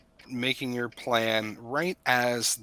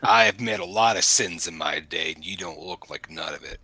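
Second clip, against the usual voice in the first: gruff brute; 4 semitones lower, 10 dB louder than usual. Acted. GM voices the brute's retort.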